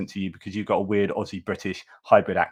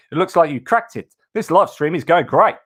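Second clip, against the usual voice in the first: bad Aussie accent; 6 semitones higher, 5 dB louder than usual. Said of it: "Apart from the accent, [name], you haven't cracked that".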